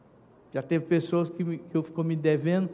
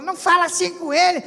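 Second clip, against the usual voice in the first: high, louder protest; wife pleads for the son; pushing back sharply and loud; wife scolding; 13 semitones higher, 7 dB louder than usual. Acted. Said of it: "a esposa já fala".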